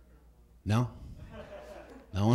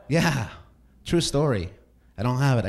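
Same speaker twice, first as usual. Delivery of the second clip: normal talking voice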